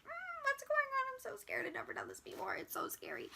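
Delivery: mock-scared squeak sinking into whisper; nervous little bean. Vlogger imitating her nervous younger self, mock scared. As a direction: play tiny and terrified, hushed. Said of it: "I was nervous. I was a little bean".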